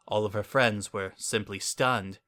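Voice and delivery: ordinary delivery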